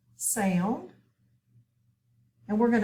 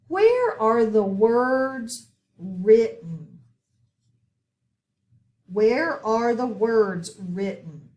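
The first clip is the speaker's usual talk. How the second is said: louder, slow, drawn-out "Where" first; slow question read; 5 dB louder, slower than usual. Shown as a demonstration. Teacher reads quiz question to students, slow and loud. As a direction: read it out slowly and loudly for the class. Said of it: "teacher reads question slowly".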